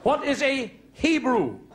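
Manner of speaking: hammed-up classroom scene; teacher and pupils. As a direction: bark teacher lines, chirp the answers.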